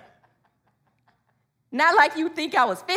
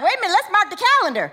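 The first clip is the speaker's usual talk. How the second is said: higher, quick amazed reaction; stop-everything surprise, pitched up; mock astonishment; 6 semitones higher, 5 dB louder, much faster than usual. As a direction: blurt it out fast, in playful disbelief. Children amazed by father's new words.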